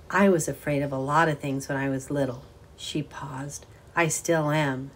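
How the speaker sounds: ordinary delivery, nothing marked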